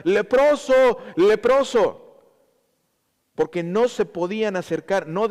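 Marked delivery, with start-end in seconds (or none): 0.0-2.2 loud, high cry of a leper; raised-voice warning cry, second one drawn out; shouted warning. Acted. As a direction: call out to keep people away. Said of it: "tocar la campana rápidamente y gritar".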